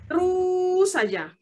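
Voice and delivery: "Terus" held very long and high before falling on "saja"; nonstop talking. Shown as a demonstration. Teacher mimicking speech without phrase breaks, "Terus" drawn out high. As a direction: stretch "Terus" into one unbroken high tone. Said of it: "Jangan sampai ngomongnya tuh terus saja".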